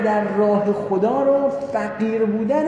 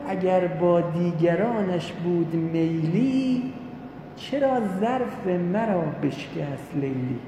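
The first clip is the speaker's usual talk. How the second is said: slow, softer poetic recitation; reciting verse; 4 semitones lower, 4 dB quieter, slower than usual. Retold or imitated. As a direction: draw out each half-line of the poem. (شعرخوانی آرام و کشیده)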